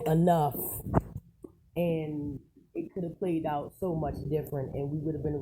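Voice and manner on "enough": forceful enough. Retold or imitated. Imagining a victim finally snapping.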